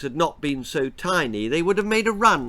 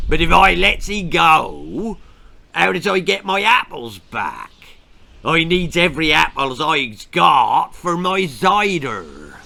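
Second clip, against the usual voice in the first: rough West Country farmer, louder; gruff farmer; 6 dB louder than usual. A narrator's reading. Farmer tells the children he needs his apples, gruff. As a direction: grumble it like a greedy yokel.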